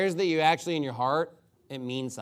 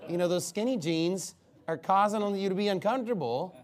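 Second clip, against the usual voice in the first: normal talking voice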